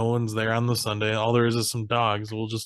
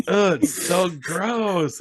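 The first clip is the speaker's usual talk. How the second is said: high, slow, drawn-out revulsion; mock disgust; 7 semitones higher, much slower than usual. Chow comes up from eating dog food, mock disgust. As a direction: groan it out slowly, grossed out.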